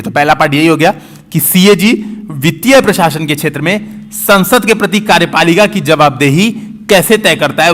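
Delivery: ordinary talk, nothing marked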